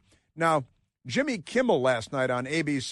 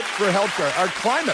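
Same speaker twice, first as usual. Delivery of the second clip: high, loud sneering parrot; sarcastic high mimicry of the list; mocking echo; 5 semitones higher, 5 dB louder than usual. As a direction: echo his words to mock him.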